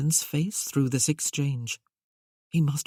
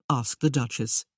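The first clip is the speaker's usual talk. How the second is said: ordinary delivery, nothing marked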